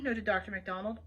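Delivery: usual speaking voice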